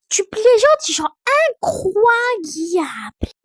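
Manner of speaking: put-on high, loud child in awe; wide-eyed little kid; amazed toddler voice. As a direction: stretch the last word slowly in wonder.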